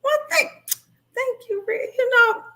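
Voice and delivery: bright high-pitched thank you. Real, not acted. Thanking Rick in the chat, pitched high and cheerful. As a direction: lift way up to thank a viewer.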